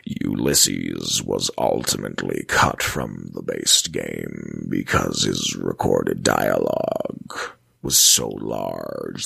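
very deep, slow, deliberate voice; Ulysses. Shown as a demonstration. Host rereads a wiki line as the game character would, slow deep impression. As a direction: drop right down low and draw out each phrase. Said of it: "read that sentence over again the way he would say it".

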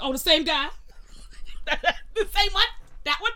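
high and loud, delighted surprise at the same guy; excited recognition. Retold or imitated. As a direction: greet him like a thrilled old friend. Retelling greeting the pest man.

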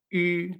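Ü with lips shaped for U while saying I; held French-style Ü; U con diéresis. Shown as a demonstration. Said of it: "esta U se pronunciaba". (Ü, la U francesa)

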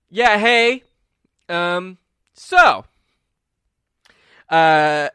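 loud, high, halting voice; reluctant speech climbing to lovesick yell; awkward young speechmaker. Acted. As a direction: blurt it out to a whole crowd. Bradley's magically amplified reluctant speech.

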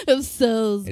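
loud, laughing mock-English echo; hammed-up English place name; exaggerated English accent. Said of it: "so fucking English".

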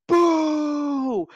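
high, long heckler's boo; loud imitated boo. Booer after the golf clap, booing loudly again. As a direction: boo as loud as you can. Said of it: "as loud as he can again".

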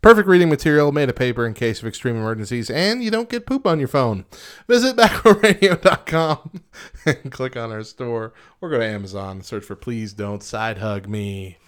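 brisk radio sponsor read. Acted. Radio host reading a sponsor spot for the book, upbeat ad read.

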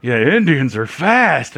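slow, drawn-out jeering imitation; mocking customer. Imitating a regular customer who made fun of them, mock drawl. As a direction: draw out the words as a retold tease. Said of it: "he was actually making fun of me".

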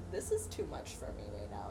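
low, soft and quick brush-off; overwhelmed, giving up. Retold or imitated. Friend imagines little Taylor setting it aside, overwhelmed and low. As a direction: imitate someone quietly checking out.

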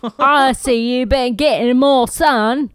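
put-on Cockney accent. [name]'s greeting in a playful Cockney accent. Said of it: "my Cockney accent".

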